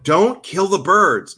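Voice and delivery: slow begging scold; pleading with the cat. Retold or imitated. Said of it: "then I'm like".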